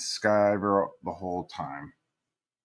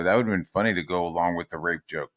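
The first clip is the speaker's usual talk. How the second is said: normal talking voice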